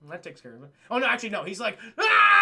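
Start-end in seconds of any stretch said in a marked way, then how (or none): 0.1-0.7 low, fading mock indifference; quiet, offhand shrug of a voice; deadpan acceptance. Acting deadpan indifferent reaction. 2.0-2.4 loud, high mock scream; full-voiced cry of frustration; imitated scream. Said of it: "He's screaming".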